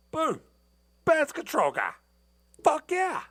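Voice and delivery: excited. Real, not acted. Telling viewers the exterminator arrived, thrilled high cheer. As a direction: celebrate like your rescue just showed up.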